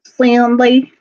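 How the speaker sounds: mock the co-host's country twang on "Sandy"; mimicked country accent. Co-host teasing the auctioneer's slip, mimicked country accent. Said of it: "You said it like that".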